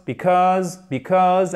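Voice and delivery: because stressed on the cause part; stress on second syllable. Shown as a demonstration. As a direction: hit the second half of because. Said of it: "accent tonique sur la deuxième".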